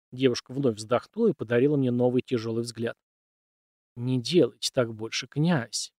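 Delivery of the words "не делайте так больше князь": ordinary delivery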